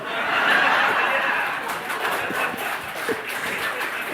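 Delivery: breathy unvoiced laughter; long real laugh. Real, not acted. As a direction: laugh it off at the escape. Laughs about escaping in the elevator.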